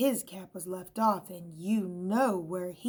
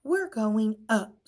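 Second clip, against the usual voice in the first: slow, louder curt command; snipped; 3 semitones higher, 8 dB louder, slower than usual. A narrator's reading. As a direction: snap it out decisively. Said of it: "snipped Scissors".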